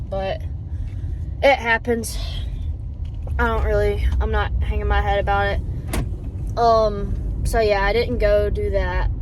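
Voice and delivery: ordinary talk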